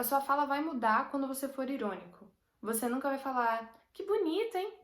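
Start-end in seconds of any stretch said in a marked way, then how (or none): 3.9-4.7 high, bright-eyed genuine praise on "Que bonito"; sincere delight. Shown as a demonstration. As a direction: sound truly thrilled by it, voice leaping up. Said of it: "um tom assim que você usaria pra expressar algo realmente legal". (elogio sincero e animado, bem agudo)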